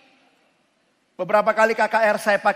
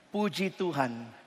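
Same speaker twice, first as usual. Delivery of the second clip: softer repeat; 8 semitones lower, 13 dB quieter, slower than usual. Shown as a demonstration. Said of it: "Dengarkan kalimat saya".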